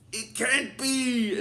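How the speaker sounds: slow, drawn-out mock dismay; stretched villain cry of disbelief; Master Vile. Imitating an upset villain after defeat, drawn-out dismay. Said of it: "Master Vile gets upset".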